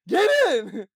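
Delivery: high, very loud playful order; mock commanding shout. Fake Uber driver insisting to a stranger, mock shout. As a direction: yell the order in pretend menace.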